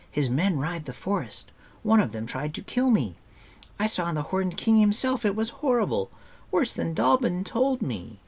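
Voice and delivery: put-on high, loud, horrified boy's outburst; bursting out. A narrator's reading. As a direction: pour out the frightening news, pitch climbing. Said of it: "Taran burst out".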